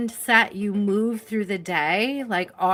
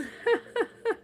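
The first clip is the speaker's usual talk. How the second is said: high giggle; 5 dB quieter than usual. Real, not acted. Laughs after apology.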